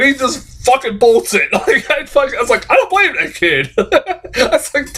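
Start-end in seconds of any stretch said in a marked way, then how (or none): 2.7-4.9 laughing. Retold or imitated. Laughing at the scared kid.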